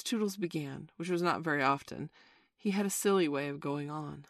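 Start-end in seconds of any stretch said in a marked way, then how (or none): none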